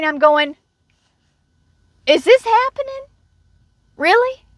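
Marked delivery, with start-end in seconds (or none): 2.1-4.4 very high, incredulous inner voice; stunned disbelief. Retold or imitated. Thinking while the coworker blocks her, stunned high disbelief. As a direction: squeak it out, unable to believe it. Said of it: "in my brain".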